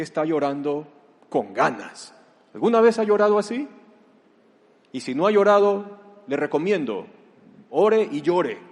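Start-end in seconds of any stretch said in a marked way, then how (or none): 2.5-8.6 loud, higher, fast challenge to the congregation. Real, not acted. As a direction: fire the question straight at them. Interpela a la congregación. (pregunta fuerte, aguda y rápida)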